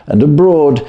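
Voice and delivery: ordinary delivery, nothing marked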